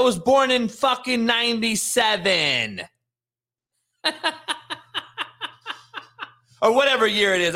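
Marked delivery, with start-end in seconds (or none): 4.0-7.5 high laugh spilling into the words; a host laughing off his own wrong year. Real, not acted. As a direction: laugh through the correction. Laughing at himself.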